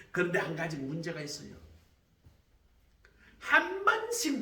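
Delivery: loud, high, driving sermon storytelling; fiery pastor belting the story to the congregation; full-force preaching. Acted. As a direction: preach it out at the top of your voice.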